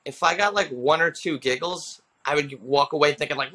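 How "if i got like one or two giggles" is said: ordinary talk, nothing marked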